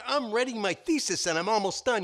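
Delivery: rabbit voice. Acted. Rabbit telling the wolf about his thesis, high and eager. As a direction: chirp it up like the busy rabbit.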